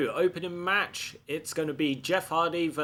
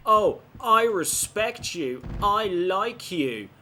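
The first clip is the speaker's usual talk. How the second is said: higher, drawn-out mimicry of a flatterer; mock Flair; 6 semitones higher, 4 dB louder, slower than usual. Player mimicking the wrestler who claimed to respect him, mocking. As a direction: put on slow, exaggerated praise. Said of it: "Flair's like".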